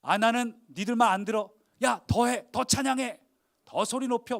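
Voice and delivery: energetic egging-on; defiant urging. Acted. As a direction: brush off the critics and rally the crowd. Jesus defiantly urging the crowd on.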